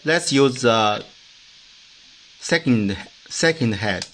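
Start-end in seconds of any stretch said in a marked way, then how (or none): none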